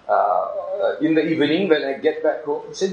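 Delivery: usual speaking voice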